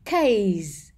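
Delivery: CASE drawn out into its final buzz; teacher's careful "Case" with lingering ending; clear end sound. Shown as a demonstration. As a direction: finish "Case" on a held, audible sibilant. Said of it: "Nhớ cái âm đuôi này".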